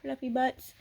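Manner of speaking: ordinary talk, nothing marked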